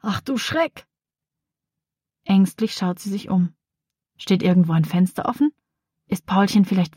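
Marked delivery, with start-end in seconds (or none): 0.0-0.9 startled cry. Acted. Girl finds bird cage empty.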